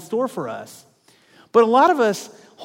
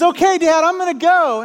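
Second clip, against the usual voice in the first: put-on much higher, louder boy's voice; a small kid bravely announcing; 11 semitones higher, 7 dB louder than usual. Son atop the twelve-foot ramp, high kid voice. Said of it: "and he says".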